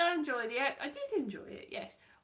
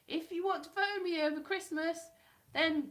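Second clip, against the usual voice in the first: quoted interviewee; 6 semitones higher, 3 dB louder than usual. Retold or imitated. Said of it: "the one that I interviewed in person said".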